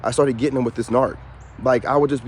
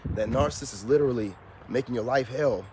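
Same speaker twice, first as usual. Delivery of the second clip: usual speaking voice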